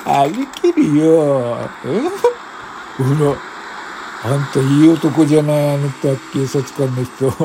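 put-on slurring drunk woman voice; sway and slur like you're plastered; dead drunk woman. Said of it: "一人の泥酔した女性が".

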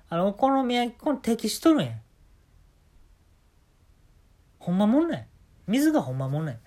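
casual Kansai-ben banter throughout; put-on Kansai accent, lightly done; Kansai dialect. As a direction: chat like a chatty Osaka guy, in Kansai dialect. 全編、あのー、関西弁で行かしてもらいます.

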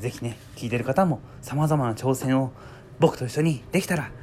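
ordinary talk

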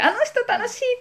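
put-on high, thrilled kid's voice; little kid squealing with glee; excited child. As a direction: gush like a delighted child. Kids saying she is fun.

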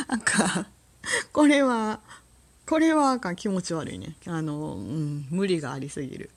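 ordinary talk, nothing marked

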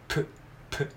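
"プ" as a short bare p puff; isolated P sound. Shown as a demonstration. Teacher showing the P sound alone, short "プ". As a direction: say "プ" as just the consonant p. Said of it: "Pの音".

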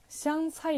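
香菜 said slowly in Mandarin, as "xiang cai"; slow Chinese pronunciation of 香菜; Chinese reading. Shown as a demonstration. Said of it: "中国語だと".

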